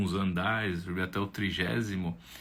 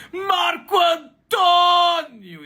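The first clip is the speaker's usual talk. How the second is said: very high, loud, drawn-out shout; elated shout; 10 dB louder, much slower than usual. Acted. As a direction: director opening his door, shouting his name high and slow. Director greets him.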